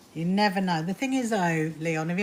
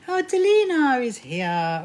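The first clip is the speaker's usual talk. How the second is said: delighted greeting; 6 semitones higher, 7 dB louder, slower than usual. Real, not acted. Greeting a viewer who just arrived.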